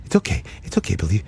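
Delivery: hushed mom. Retold or imitated. Imitating the mother hushing her son. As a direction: whisper soothingly like a mom. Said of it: "he's like".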